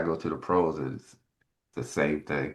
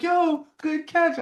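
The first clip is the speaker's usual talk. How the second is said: much higher, slow, drawn-out mock teammate; teammates' praise; 5 dB louder, much slower than usual. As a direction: stretch out a cheery teammate's compliment.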